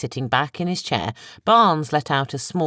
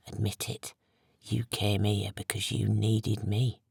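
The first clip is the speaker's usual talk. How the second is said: low whisper; 5 semitones lower, 9 dB quieter than usual. A narrator's reading. Dave leaning in to Barnes, low insistent whisper. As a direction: lean in and whisper it close. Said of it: "spoke in a low whisper".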